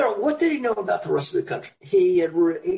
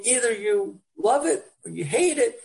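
ordinary talk, nothing marked

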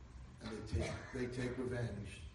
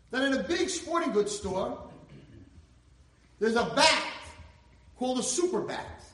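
high, loud, fervent storytelling; preaching at full force; 13 semitones higher, 11 dB louder than usual. Acted. Preacher telling the crowd about the boy, at full force. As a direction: belt the story out to the hall.

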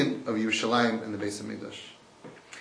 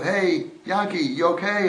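calling out; 4 semitones higher, 5 dB louder than usual. Acted. Calling down into a pit.

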